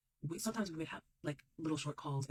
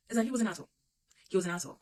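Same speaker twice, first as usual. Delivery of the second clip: emphatic declaration; 5 semitones higher, 6 dB louder, much faster than usual. Real, not acted. Declaring ex an asshole.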